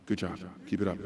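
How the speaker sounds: very low, soft, muted; understated praise, barely voiced; nighttime DJ praise. Shown as a demonstration. Adult to student who did right, low-key praise. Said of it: "we're using the wrong voice".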